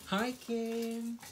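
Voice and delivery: very high-pitched, lilting hello; high sing-song greeting. Real, not acted. Calling a greeting to viewer.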